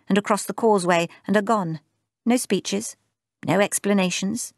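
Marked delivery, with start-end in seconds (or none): none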